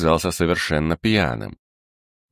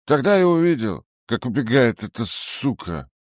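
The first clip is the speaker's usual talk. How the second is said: put-on much higher whiny drunken tailor; whining drunk tailor; 8 semitones higher than usual. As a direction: whine and complain, tipsy. Tailor voiced high.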